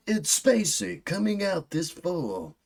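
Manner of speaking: high, slow mock trailer announcer; hammed-up trailer announcer; movie trailer voice. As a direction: pitch it like a hammed-up film promo.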